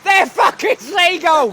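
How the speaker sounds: loud, high and slow outburst; exasperated shout. Real, not acted. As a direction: yell it in frustration. Heated argument over bird type.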